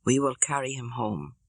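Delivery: ordinary delivery, nothing marked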